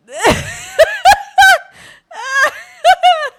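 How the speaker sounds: high shrieking laugh; real howl of laughter; screaming laughter. Real, not acted. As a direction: lose it completely, loud and high. Cracking up.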